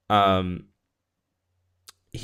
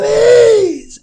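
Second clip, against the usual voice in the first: much higher, much louder anguished cry; desperate shout; 14 dB louder than usual. Retold or imitated. Distraught Inigo begging Fezzik at the door, shouting. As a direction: scream the plea like he's losing everything. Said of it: "And he goes".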